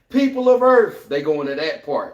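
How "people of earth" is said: loud high alien herald voice; alien announcement. Retold or imitated. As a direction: proclaim it like an invading soldier.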